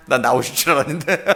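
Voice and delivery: teasing remark breaking into laughter; laughing tease. Real, not acted. Preacher ribbing absent members about Friday night prayer, laughing. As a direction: tease them, then burst out laughing.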